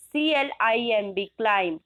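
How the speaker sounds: letter by letter, then the word climb; spelled then said. Shown as a demonstration. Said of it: "how to pronounce this word?".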